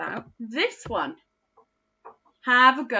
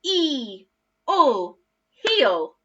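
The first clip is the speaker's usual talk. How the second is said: "E, or" separate, then "heel" louder; sound then blend; 4 semitones higher, 5 dB louder, much slower than usual. Shown as a demonstration. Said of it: "Let's do that one more time together".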